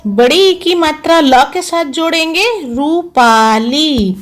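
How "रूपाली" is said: रूपाली said very slowly and drawn out; रूपाली stretched like dictation. Shown as a demonstration. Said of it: "dictation word for pupils".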